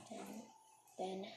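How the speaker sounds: usual speaking voice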